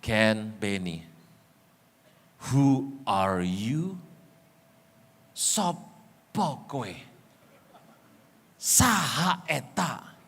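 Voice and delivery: Isaac slowly asking his disguised son who he is; old father asking. Retold or imitated. Blind old father asks who.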